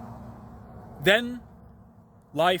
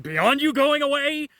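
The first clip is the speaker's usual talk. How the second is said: sarcastic retort; 10 semitones higher, 7 dB louder, slower than usual. Retold or imitated. Sarcastic reply to demon.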